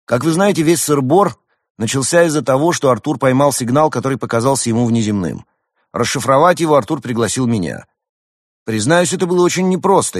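high, loud, ringing testimony; Roman, confident young hedgehog. A narrator's reading.

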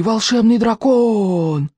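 high, very slow sigh of wonder; superstitious crowd marvelling; awed chorus. A narrator's reading. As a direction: stretch every vowel in amazement. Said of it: "хором выдохнули они".

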